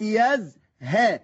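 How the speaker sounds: يزهى stretched very slow, the third letter held on its fatha; very slow يزهى, lingering on the fatha; drawn-out demo word. Shown as a demonstration. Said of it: "تركز بودنك مع الحرف الثالث".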